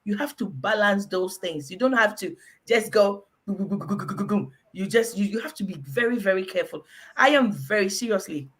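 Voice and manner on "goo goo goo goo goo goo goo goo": rapid run of throaty gulps; imitated gulping noise. Warning against overeating, imitated gulping noise. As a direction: mimic someone guzzling food without stopping.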